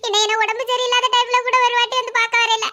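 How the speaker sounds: wounded complaint dissolving into sobs; breaking into tears. Acted. Stepmother lamenting that her daughter never visited, tearful. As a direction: let the hurt spill over into crying. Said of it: "எதுக்கு சித்தி அழுறீங்க?".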